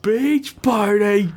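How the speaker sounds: high, slow silly captain drawl; hammed-up Brack impression. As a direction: pitch it way up and stretch it. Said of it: "Brack impression again".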